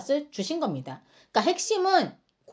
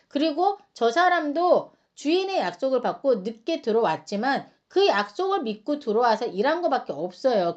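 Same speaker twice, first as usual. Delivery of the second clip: ordinary talk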